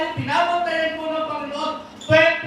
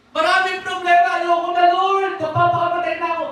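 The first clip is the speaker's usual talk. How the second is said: despairing cry to God; 6 semitones higher, 4 dB louder than usual. Acted. Voicing despairing prayer.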